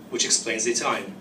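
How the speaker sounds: ordinary delivery, nothing marked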